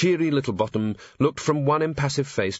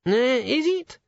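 put-on high, hesitant young dwarf; timid, higher voice; nervous high voice; 13 semitones higher, slower than usual. A narrator's reading. Cheery, edge in voice.

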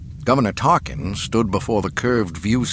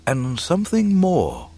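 higher, wondering hint of mystery; almost mystical; 8 semitones higher than usual. A narrator's reading. Old mentor hinting to his pupil, mystical wonder. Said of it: "Kenobi went on almost mystically".

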